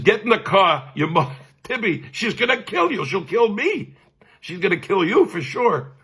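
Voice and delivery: high, urgent mock scolding; scolding older brother. Brother ordering him into the car, high-pitched scolding. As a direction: imitate an alarmed brother warning of trouble.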